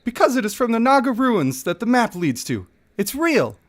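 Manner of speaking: high, pushy dwarf bargaining; desperate gambler begging for double or nothing; eager dwarf. Acted.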